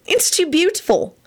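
very high, thrilled admiration; excited. A narrator's reading. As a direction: gush over the pretty wrapping. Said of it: "she said excitedly".

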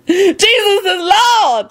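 high, drawn-out shriek. A narrator's reading. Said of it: "Lucretia shrieked".

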